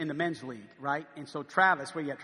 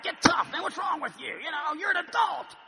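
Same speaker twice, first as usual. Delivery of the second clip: mock chewing out; 9 semitones higher than usual. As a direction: bark at him like a tough coach. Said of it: "rip him and say".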